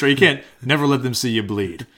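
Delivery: ordinary delivery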